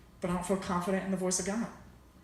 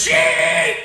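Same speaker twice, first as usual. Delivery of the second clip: screamed, much higher and louder; furious yell; 12 dB louder than usual. Retold or imitated. Angry visitor yelling at sheep, full furious scream. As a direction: hurl it across the field in frustration. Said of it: "start yelling swear words".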